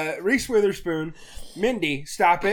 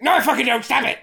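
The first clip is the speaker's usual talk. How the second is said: louder, higher mock snap-back; mock outburst; 6 semitones higher, 6 dB louder, faster than usual. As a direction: act out a loud, indignant refusal. Acted snappy quote in retelling.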